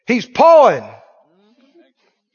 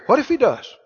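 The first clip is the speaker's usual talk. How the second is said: dismissive comeback; 4 semitones higher, 3 dB quieter than usual. Acted. Preacher answering somebody worried about spears, dismissive. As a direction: brush the worry aside.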